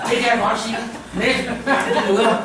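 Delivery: high, loud, talking through laughter; can't stop laughing while telling; laughing through words. Acted. Mother speaks while laughing. (høj og leende mor)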